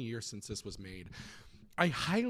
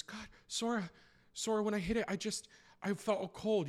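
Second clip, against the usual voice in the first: pleading Riku; 8 semitones higher than usual. Acted.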